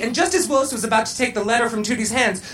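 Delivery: ordinary delivery, nothing marked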